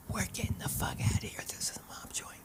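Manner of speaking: quick, hushed whisper; urgent whisper. Retold or imitated. Husband warning wife about a mob joint, urgent whisper. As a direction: whisper it fast into an ear. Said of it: "leans over my ear and says".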